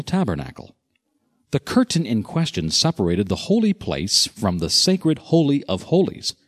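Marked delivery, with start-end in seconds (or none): none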